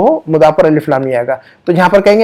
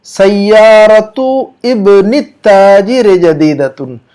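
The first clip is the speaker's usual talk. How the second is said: slow model reading; 2 semitones higher, much slower than usual. Shown as a demonstration. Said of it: "उसकी अरबी यूं बनेगी".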